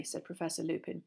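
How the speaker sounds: plain reading voice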